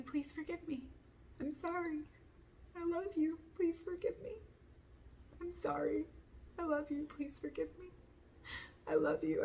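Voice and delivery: high-pitched, pleading mantra; forgiveness prayer. Real, not acted. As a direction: repeat it as a heartfelt prayer, pitched well up. Repeats forgiveness prayer in raised pitch.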